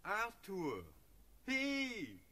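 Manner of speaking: high, drawn-out hail from a distance; calling out. Acted. Friend calling the sleepwalking Prince awake, hailing him. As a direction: call out to rouse a dreamer. Hohenzollern calls to wake the Prince.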